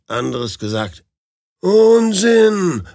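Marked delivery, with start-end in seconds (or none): 1.6-2.8 loud, high, indignant cry of protest. Acted. The newlywed loudly protests to the fellow travellers, indignant. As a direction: snap back that it is nonsense. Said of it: "protestiert der Neuvermählte".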